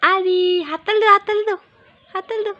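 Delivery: high, bright call to clap; cheering prompt. Real, not acted. Parent cheering a toddler to clap, high excited call.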